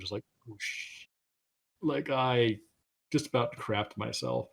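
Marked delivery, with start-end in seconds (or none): 0.5-1.1 soft, voiceless whoosh; imitated whoosh. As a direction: breathe out a hushed whoosh. Imitates sinking feeling as heart drops.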